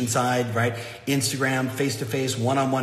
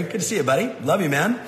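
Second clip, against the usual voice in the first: warm excited greeting; 5 semitones higher, 3 dB louder than usual. Real, not acted. Greeting viewers in live chat.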